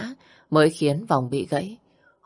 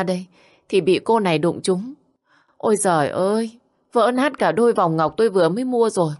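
playing the victim to the thugs; whining complaint; 4 semitones higher than usual. Giả vờ than khóc với đàn anh. (giọng cao than vãn giả vờ oan ức)

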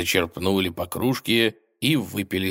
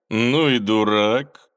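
slow, loud contemptuous scoff; 4 semitones higher, 6 dB louder, slower than usual. A narrator's reading. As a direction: sneer it out. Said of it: "хмыкнул Палкович".

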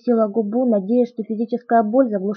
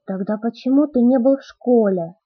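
usual speaking voice

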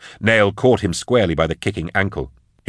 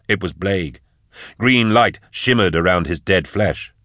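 usual speaking voice

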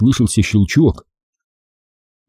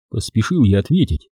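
plain reading voice

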